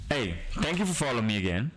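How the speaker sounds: normal talking voice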